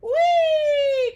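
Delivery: squealed very high and loud; Squirmy squealing while flying around bulbs; whee cry. Acted. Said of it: "cried Squirmy".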